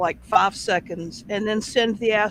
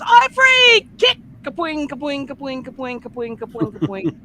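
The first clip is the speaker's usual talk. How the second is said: very high, loud cry of freedom, kicks trailing off; an escaped frog yelling free, then kicking away; gleeful cartoon frog; 5 semitones higher, 7 dB louder than usual. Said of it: "The frog goes".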